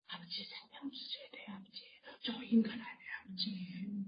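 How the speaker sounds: hushed, breathy pleading whisper; whispered prayer. Acted. Preacher acting a believer praying for family, whispered. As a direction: whisper the plea as if at a bedside.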